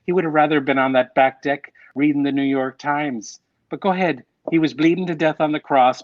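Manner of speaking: imitating an Irish mother's accent, guilt-tripping; a light Irish brogue, sarcastic and scolding. Son imitates his Irish mother scolding him over mass, Irish accent. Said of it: "My mother would say in her Irish accent".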